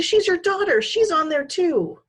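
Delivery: high and desperate; a boy begging for his sister's life; desperately. A narrator's reading. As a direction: plead in desperation. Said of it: "Jed said desperately".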